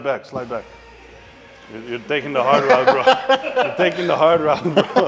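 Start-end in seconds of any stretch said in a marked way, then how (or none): 1.7-5.1 laughing. Real, not acted. Laughing teasing spotter.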